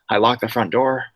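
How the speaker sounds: usual speaking voice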